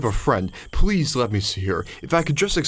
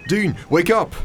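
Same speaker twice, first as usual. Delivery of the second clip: a desperate plea for her to wake up; urgent call; 6 semitones higher, 3 dB louder than usual. Acted. Duncan shaking the dying dean.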